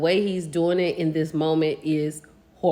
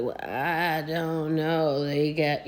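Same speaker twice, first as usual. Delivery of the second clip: slow, dragged-out imitation of Kirk's talk; mock slow-talker imitation; slow turtle talk; 5 semitones lower, 6 dB quieter, slower than usual. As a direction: drawl it out like a turtle. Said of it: "you know how he talks, like a turtle".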